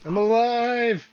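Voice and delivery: loud, slow triumphant cry; triumphant shout. Acted. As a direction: burst back to life shouting. Player bursts back to life.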